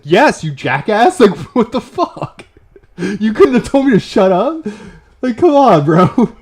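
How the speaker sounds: loud, high, half-laughing outrage at a friend; mock exasperation. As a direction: yell at a buddy who let you embarrass yourself. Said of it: "And I was like".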